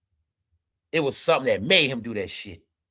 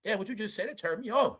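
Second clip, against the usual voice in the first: higher-pitched imitation of a come-on; mock flirty boss; 7 semitones higher, 4 dB quieter than usual. Boss hitting on a woman at her job, mock flirty. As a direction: play a boss coming on to a worker. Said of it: "Your boss say to you".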